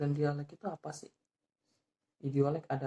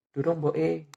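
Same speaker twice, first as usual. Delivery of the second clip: Javanese dialect; 3 semitones higher, 9 dB louder, slower than usual. Shown as a demonstration. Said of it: "lalu dijawab".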